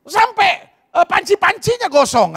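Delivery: high complaining wife voice; mock whiny wife. Wife grumbling to the pastor, high and whiny. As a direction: whine it like a fed-up wife.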